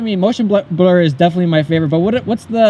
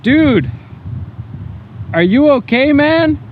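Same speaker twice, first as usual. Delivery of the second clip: calling out; 9 semitones higher than usual. Real, not acted. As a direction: call out to check on someone. Calling out to someone.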